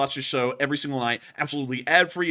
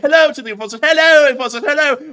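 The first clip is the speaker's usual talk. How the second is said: silly high greeting voice; 11 semitones higher, 11 dB louder than usual. Pretend. Greeting the Enforcer in funny voice.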